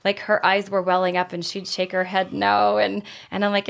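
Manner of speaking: ordinary talk, nothing marked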